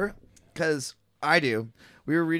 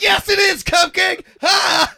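high, loud mocking yell; taunting shout; 9 dB louder, slower than usual. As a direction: jeer it at full volume. Everyone taunting the victim.

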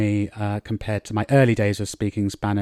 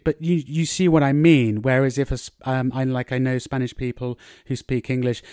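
usual speaking voice

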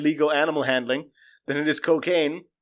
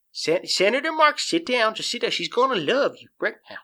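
lightly acted higher-pitched urging; coaxing handler; 5 semitones higher than usual. Handler settling a senator before a tiger, mock coaxing. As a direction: coax them like a hammy animal handler.

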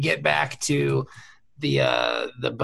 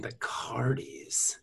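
slow hushed whisper; 5 semitones lower, 11 dB quieter, much slower than usual. Acted. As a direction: breathe the name secretly. Repeats the name, then whispers it.

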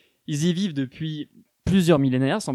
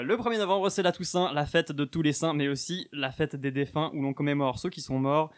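normal talking voice

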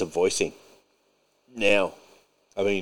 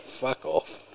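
muttered dismissal; 3 semitones higher, 11 dB quieter than usual. Real, not acted. Dismissing the critics.

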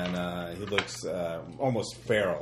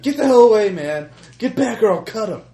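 frantic shouting hostage taker; 13 semitones higher, 13 dB louder than usual. Acted. Kidnapper threatening with knife.

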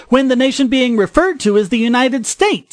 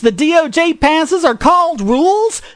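high-pitched, exasperated disbelief; incredulous scolding; 6 semitones higher than usual. Real, not acted. Podcaster berating Mason over basic civics, incredulous.

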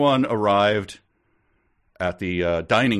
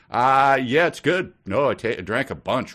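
badly faked tasting; 6 semitones higher, 4 dB louder than usual. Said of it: "nobody's watching them".

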